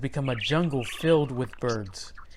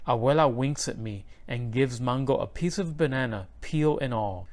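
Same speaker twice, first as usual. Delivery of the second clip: plain reading voice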